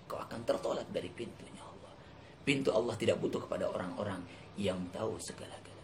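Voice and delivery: drops to a soft whisper; hushed sermon. Real, not acted. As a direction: draw the listeners close in a whisper. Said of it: "khotbah yang berbisik". (khotbah berbisik lirih)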